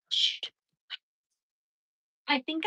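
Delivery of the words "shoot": gasping, much higher soft shoot; gasp of dismay. Real, not acted. Spilling river dirt, a gasp then a soft curse. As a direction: catch your breath at the spill.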